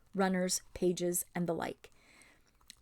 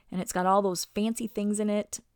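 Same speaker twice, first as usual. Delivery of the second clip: ordinary delivery, nothing marked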